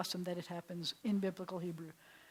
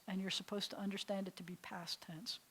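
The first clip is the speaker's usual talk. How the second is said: whisper; 9 dB quieter than usual. Real, not acted. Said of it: "unvoiced whisper".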